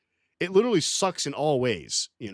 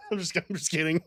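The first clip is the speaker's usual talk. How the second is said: laughing; 4 semitones higher, 4 dB quieter, faster than usual. Real, not acted. Laughing at own joke.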